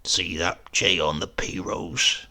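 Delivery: slow, low sly murmur; hint at mischief like a cunning old man; a lightly voiced sly uncle. A narrator's reading. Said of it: "a faint flicker of the old cunning".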